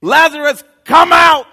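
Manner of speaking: high, loud shout of command. Acted. Jesus commanding the dead man out, full shout. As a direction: bellow it into the tomb. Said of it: "he says".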